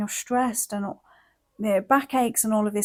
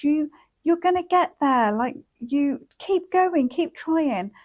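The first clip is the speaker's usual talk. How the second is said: put-on higher, sing-song praise like cheering on a child learning to walk; encouraging a toddler; 8 semitones higher, 4 dB louder than usual. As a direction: gush over a small child's first steps, bright and high. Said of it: "we wanna take that same energy".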